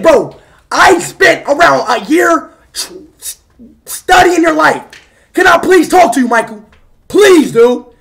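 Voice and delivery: loud, insistent pleading yell; screaming. Real, not acted. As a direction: beg at full volume for a chance to talk. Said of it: "You're screaming too much".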